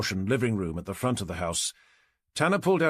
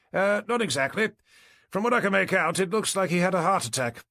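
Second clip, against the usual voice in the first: higher-pitched doctor voice; doctor explaining; 8 semitones higher than usual. A narrator's reading.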